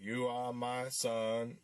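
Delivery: put-on slow, wooden voice with a lost accent; Will Smith. Mocking the actor's line from the trailer, wooden impression. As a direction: say it slowly and stiffly, a bit like Forrest Gump. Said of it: "goes into that wooden voice".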